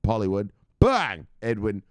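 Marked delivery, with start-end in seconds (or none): 0.8-1.3 loud bang shout. Real, not acted. Shout-out bang after name.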